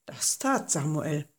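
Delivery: ordinary delivery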